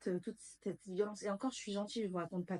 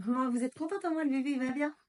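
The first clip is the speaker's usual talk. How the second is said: mock cheerful nurse; 6 semitones higher, 4 dB louder than usual. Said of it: "La seule chose qu'on m'a dit".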